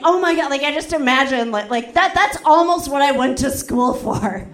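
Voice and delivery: ordinary talk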